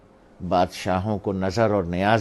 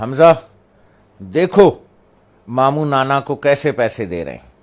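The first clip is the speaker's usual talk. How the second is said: loud pointed remark; 4 semitones higher, 9 dB louder than usual. Retold or imitated. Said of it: "اپنے نواسے سے کہا".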